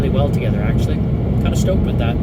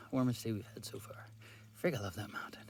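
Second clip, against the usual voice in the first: whispering; 5 semitones lower, 24 dB quieter, faster than usual. Real, not acted. Hushed remarks during climbing exam.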